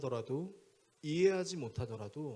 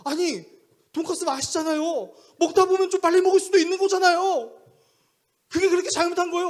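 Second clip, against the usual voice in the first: very high, loud whining defence; aggrieved protest; 13 semitones higher, 13 dB louder than usual. Retold or imitated. Young man arguing with pastor about eating fast, aggrieved. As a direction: wail back as if wrongly accused.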